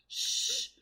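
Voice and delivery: soft unvoiced shh; child hushing parents in a whisper; whispered shush. A narrator's reading. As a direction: hush them in a whisper. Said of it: "whispered the little person".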